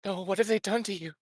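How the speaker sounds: anguished sister. Acted. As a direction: voice pulled high by horror at his state. Sister sees broken brother.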